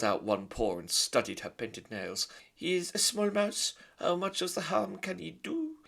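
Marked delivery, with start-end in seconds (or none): 2.5-5.8 put-on very high, loud French-accented mother; haughty French lady; French mother mouse. Antoinette answering her husband, high French haughty.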